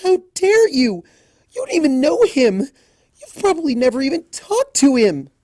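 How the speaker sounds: high loud furious scolding; angry defence of a friend; tearful outrage. A narrator's reading. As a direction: scold them, fighting tears. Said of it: "not yet shed tears".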